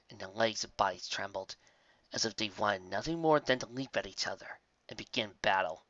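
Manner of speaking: ordinary delivery, nothing marked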